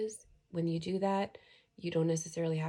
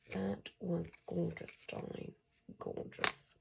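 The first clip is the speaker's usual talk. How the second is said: put-on a touch of South African accent; light South African lilt; 7 dB quieter, slower than usual. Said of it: "that was a South African accent".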